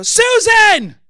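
high, full-voice scream of a name; woman's alarmed shout. Retold or imitated. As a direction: cry out across a store, imitating a panicked woman. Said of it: "I heard this woman go".

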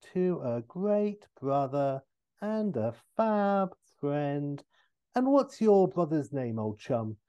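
drawn-out dictation, lifted then chummy; Austin Powers. Acted. Austin writing a dedication in the book, slow chummy impression. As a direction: stretch the words as you write. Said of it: "he's writing in the book".

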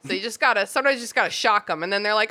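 ordinary talk

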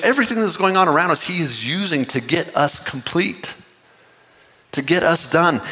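ordinary delivery

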